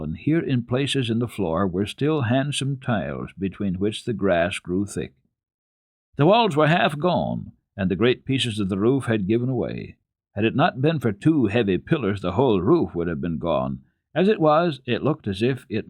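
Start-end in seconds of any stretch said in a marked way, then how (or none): none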